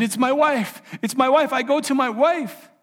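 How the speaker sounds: half-voiced emotion of a husband in shock; overcome and crying. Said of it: "crying and crying like a baby".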